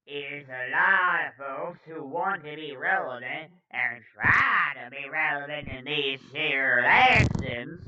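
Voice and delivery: loud, stretched-out villain oratory; Palpatine voice. Acted. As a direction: proclaim it like a scheming emperor. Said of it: "I'll give him a Palpatine voice".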